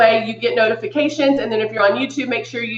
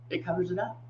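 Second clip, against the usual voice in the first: soft aside; 5 semitones lower, 15 dB quieter, faster than usual. Real, not acted. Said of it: "repeated softly".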